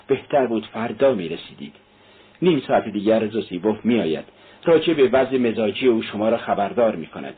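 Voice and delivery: usual speaking voice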